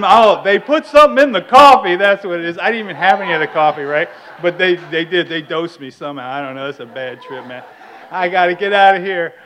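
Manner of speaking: loud frantic rant, easing then surging; acted panic. Newcomer convinced his coffee was dosed, acted panic. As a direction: rant in mock paranoid panic.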